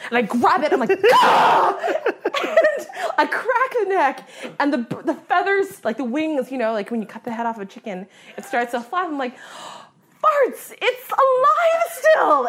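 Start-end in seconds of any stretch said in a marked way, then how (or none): 1.1-1.8 high loud comic shriek; retold scream. Hunter grabbing the wounded bird, high scream. 9.4-12.5 high, drawn-out squeal; comic horror. Retold or imitated. Hunter seeing the bird still flapping, horrified squeal.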